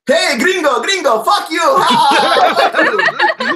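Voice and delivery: put-on high, loud, jeering kid voices; mock the kids' shouted taunt. Chilean street kids taunting a missionary, jeering. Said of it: "little kids like".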